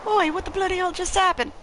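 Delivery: a cockney lad, startled; British character voice. Acted. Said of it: "I usually can do better voices than this".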